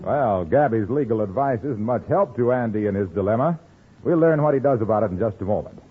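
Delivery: ordinary talk, nothing marked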